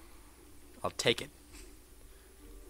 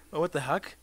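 ordinary talk

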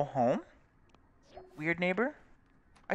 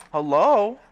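a higher, louder "Hello?" that falls at the end; insistent call; 9 semitones higher, 9 dB louder than usual. Real, not acted. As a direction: call "Hello?" again, high and loud, falling. Calling out again, more insistent.

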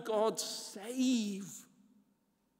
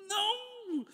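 high, hammed-up horrified no; mock horrified cry; 13 semitones higher than usual. Preacher voicing Paul's horror, comic high cry. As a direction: yelp it in comic dismay. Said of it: "if a person was like".

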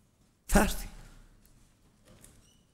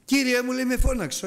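put-on eager boy Samuel; 11 semitones higher than usual. Boy Samuel voiced in Bible story.

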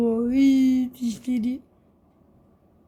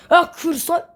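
startled; 6 semitones higher, 7 dB louder than usual. Real, not acted. Near crash.

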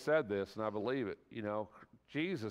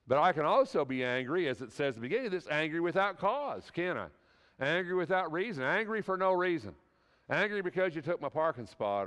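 rising, louder preacher's build; preaching at full force; 5 semitones higher, 4 dB louder than usual. Acted. Preacher warns the congregation against anger without cause, pressing louder. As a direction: preach it hard and climbing.

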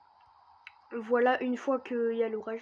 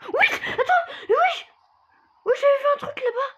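much higher, louder giddy laughing talk; real nervous laughter; 11 dB louder than usual. Real, not acted. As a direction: laugh it out in a squeaky, rattled voice. Nervous reaction to spooky discovery.

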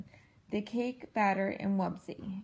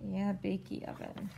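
plain reading voice

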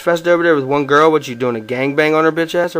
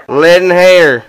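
louder repeat, pushing over the line; louder second listen here; louder insistence; 3 semitones higher, 7 dB louder, much slower than usual. Real, not acted. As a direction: raise it to demand attention.

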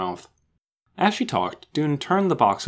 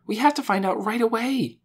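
high, impatient insistence; excited urgency; 12 semitones higher than usual. A narrator's reading. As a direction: press eagerly for action now. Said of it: "she was so excited".

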